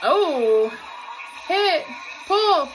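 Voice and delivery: cheering the squad's moves as they hit; excited call-out. Real, not acted. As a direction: shout the counts along with the team. Calling along with cheer routine.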